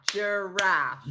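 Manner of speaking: "Giraffe" split into two clapped beats, ju-raff; clapped out syllables. Shown as a demonstration. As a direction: clap out "Giraffe" beat by beat. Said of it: "Just clap it out".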